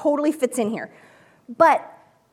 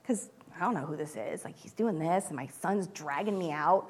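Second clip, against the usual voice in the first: weary; 7 semitones lower, 8 dB quieter than usual. Retold or imitated. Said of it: "was she, like, tired of hearing".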